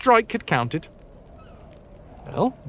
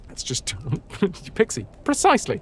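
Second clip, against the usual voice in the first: ordinary delivery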